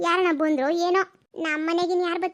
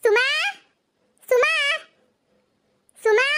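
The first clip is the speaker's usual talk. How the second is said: calling out; 10 semitones higher, 5 dB louder, slower than usual. Acted. Calling for neighbour by name.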